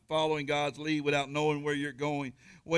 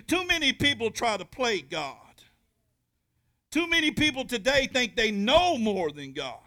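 much higher, much louder preaching; preaching at full force; 8 semitones higher, 7 dB louder than usual. Acted. Preacher voicing God to the congregation, full-force preaching. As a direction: drive it home to the church.

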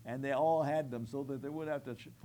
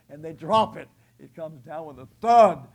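loud preaching thud; 3 semitones higher, 18 dB louder than usual. Real, not acted. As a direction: let the thud land hard. Cross dropped into hole.

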